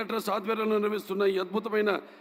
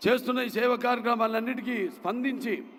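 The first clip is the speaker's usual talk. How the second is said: normal talking voice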